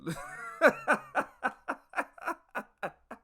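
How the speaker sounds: long high cackling laugh; real laugh. Real, not acted. Storyteller amused by the mild punishments planned, laughing. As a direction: burst out laughing at your own tale.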